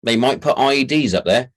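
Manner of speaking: ordinary delivery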